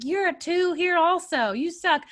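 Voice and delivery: high, drawn-out mocking retort; imitated public jeer. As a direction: put someone down as the offended locals. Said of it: "people would be like".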